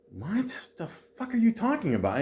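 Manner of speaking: exasperated at the manager's non-answer; baffled exasperation. Retold or imitated. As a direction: disbelief at the nonsense. Retold baffled outburst at manager.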